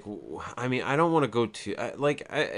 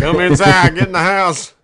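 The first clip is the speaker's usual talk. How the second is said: much louder hammed-up yelled order; mock hillbilly yell; 4 semitones higher, 11 dB louder than usual. Co-host joining in on the hillbilly's orders, loud mock yell. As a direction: boom it out like a panicked mountain man.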